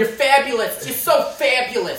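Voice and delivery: high, loud, flamboyant Fabulous Freddy; a loud sing-song call of the Fabulous Freddy name. Pretend. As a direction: belt it out like a showy restaurant owner.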